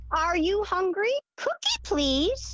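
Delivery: high, slow parrot imitation, lightly done. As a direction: mimic the parrot asking for a cookie. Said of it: "she's saying".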